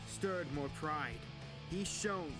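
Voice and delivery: ordinary delivery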